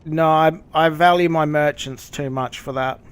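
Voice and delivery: ordinary delivery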